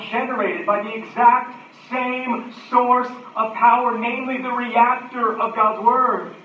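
loud, high proclamation; full-force preaching. Acted. Preacher links affection and proclamation for the congregation, full-force preaching.

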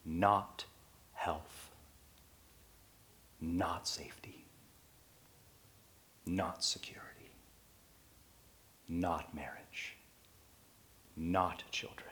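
hushed, deliberate listing; whispered list. Real, not acted. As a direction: breathe each item quietly. Hushed list of non-promises.

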